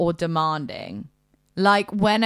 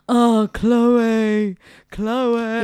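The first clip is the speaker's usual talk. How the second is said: louder breathy mock moan; mock moaning name; 3 semitones higher, 6 dB louder, much slower than usual. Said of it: "whilst going".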